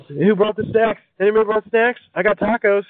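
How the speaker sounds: high, peppy party greeter voice; excited party host. Pretend. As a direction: greet the crowd like an eager host.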